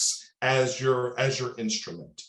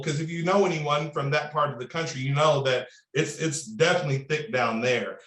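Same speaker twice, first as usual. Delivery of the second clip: usual speaking voice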